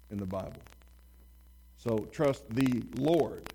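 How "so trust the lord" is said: slow, "the Lord" emphasized; slow and deliberate, landing on "Lord"; stress on the Lord. Shown as a demonstration. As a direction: lean on "the Lord", spoken slowly. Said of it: "now the emphasis is on who".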